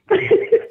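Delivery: short high airy laugh; real laugh, breathy and high. Real, not acted. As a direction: laugh at your own joke. Laughs at the auntie remark.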